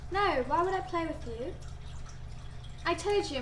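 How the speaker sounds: ordinary delivery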